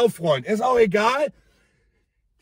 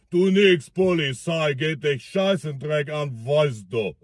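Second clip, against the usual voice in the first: put-on lower, slurred Russian accent; drunk Russian accent; 9 semitones lower than usual. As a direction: drunk Russian brushing off the police. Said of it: "Mit so einem russischen Akzent". (tiefer betrunkener russischer Akzent)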